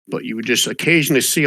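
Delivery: ordinary delivery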